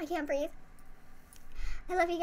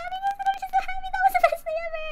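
high, loud burst of happiness; real giddy fan shouting happily; overjoyed squeal; 12 semitones higher, 5 dB louder, faster than usual. Real, not acted. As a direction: let the joy burst out. Fan overjoyed about BTS.

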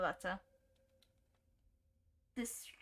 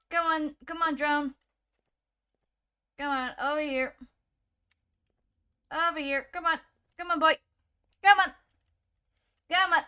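raised, higher calls in short bursts; urging call; 5 semitones higher, 7 dB louder than usual. Real, not acted. Player calling a drone to come over here, urging call. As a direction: call it over, getting more insistent.

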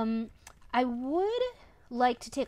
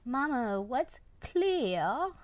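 put-on child's question with British-accented "clear", higher pitched; imitated British accent; 5 semitones higher, much slower than usual. Son asking mama about the toy, imitated British accent. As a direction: mimic a kid copying a British accent. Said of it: "He means clear with a British accent".

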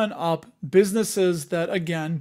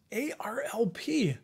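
whisper; 5 semitones higher, 6 dB quieter than usual. Real, not acted. Reading ticker to himself.

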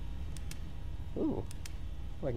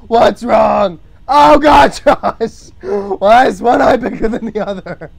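loud, high wail of dismay; loud laughing exasperation; mock horror; 5 semitones higher, 11 dB louder than usual. Real, not acted. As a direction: cry out at a drawing gone wrong. Mock horror at his own drawing.

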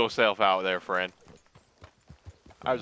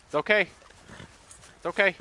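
soothing; 9 semitones higher, 4 dB louder than usual. Real, not acted. Soothing the spooked horse.

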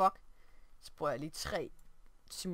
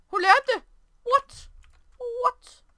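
much higher and louder, stunned disbelief; shocked surprise; 14 semitones higher, 12 dB louder, much slower than usual. Real, not acted. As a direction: squeal in disbelief. Surprised reaction to game.

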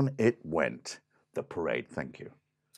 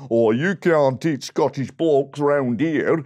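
put-on loud Blackburn-accented protest; indignant Blackburn bandsman; angry Blackburn bloke; 7 dB louder than usual. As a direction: tell the cat off loudly in a Blackburn accent. Blackburn band attacks the cat.

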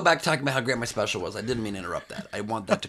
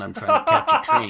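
shrieked, much higher and louder; exasperated outburst; 5 dB louder than usual. Acted. Comedian to podcast host, frustrated high shriek. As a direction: suddenly yell it up high in frustration.

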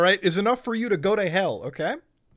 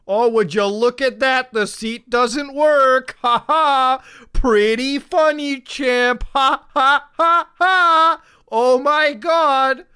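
loud, slow, sarcastic put-on voice with fake laughs; mocking read; 5 semitones higher, 6 dB louder, much slower than usual. Mocking the iFunny seat joke in a loud, slow sneering voice. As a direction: ham up a smug mocker cackling ha ha.

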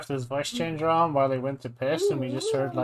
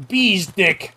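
voiced quote of a game character; imitating game character; 4 semitones higher, 7 dB louder than usual. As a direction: repeat the game line in his voice. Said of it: "I like the one where he's like".

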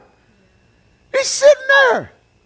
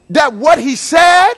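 ordinary talk

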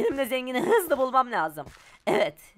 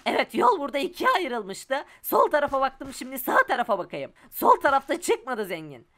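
normal talking voice

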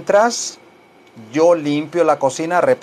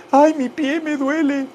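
mock pain; 10 semitones higher than usual. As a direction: whimper over the foot in a put-on squeak. Mocks pain from dog bite. (quejido fingido en falsete)

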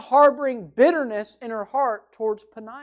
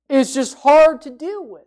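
"hard" hit loud and held; stress on hard; 3 semitones higher, 8 dB louder than usual. Shown as a demonstration. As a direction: lean into the word "hard". Preacher stresses a hard person.

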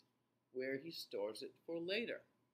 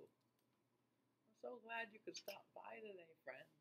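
whispering; 6 semitones higher, 10 dB quieter than usual. Real, not acted. Whispered greeting.

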